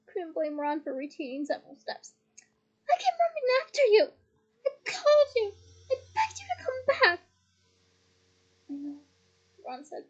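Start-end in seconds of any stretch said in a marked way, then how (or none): 2.9-7.2 high, loud, near-crying reproach; dire accusation. A narrator's reading. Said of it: "in dire accusation". 8.7-9.0 softer, subdued reply; meek admission. A narrator's reading. Ron meekly accepting the blame.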